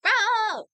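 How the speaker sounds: short high shriek, imitating a frantic mother; a quick hammed-up cry of panic; mock panic scream. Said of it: "was in that, like".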